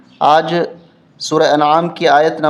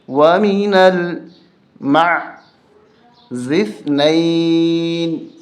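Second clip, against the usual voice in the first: recited slowly; much slower than usual. Shown as a demonstration. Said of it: "رواں پڑھیں گے".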